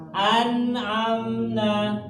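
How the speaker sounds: slow, high recited "an'amna", no nasal hum; clear n. Shown as a demonstration. Teacher showing nun meeting ain, clearly recited. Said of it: "Bacanya jelas".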